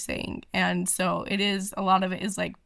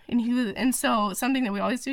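usual speaking voice

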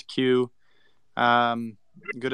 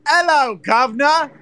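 much higher, louder mock Cockney "Hello, governor"; mock British accent; 14 semitones higher, 9 dB louder than usual. Showing Americans mix up accents, in a high, loud mock British accent. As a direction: caricature of a London Cockney greeting. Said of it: "Look how different those were".

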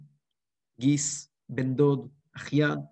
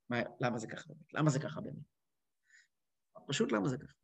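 low, hushed self-questioning; quiet pondering; 5 semitones lower, 11 dB quieter than usual. Acted. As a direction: wonder it softly, almost to yourself. Voices a puzzled, guilty afterthought. (תהייה שקטה ונמוכה)

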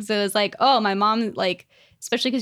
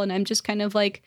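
usual speaking voice

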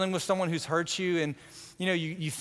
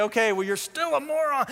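smug put-down; 7 semitones higher than usual. Retold or imitated. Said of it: "And you're gonna say".

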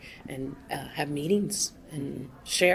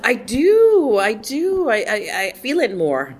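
much higher and louder, eager yes; excited agreement; 6 semitones higher, 9 dB louder, slower than usual. Real, not acted. Agreeing eagerly with the interviewer, excited and loud. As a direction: burst out agreeing with glee.

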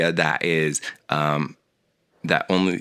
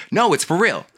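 emphatic insistence; 10 semitones higher, 4 dB louder than usual. Real, not acted. Insisting against the joke.

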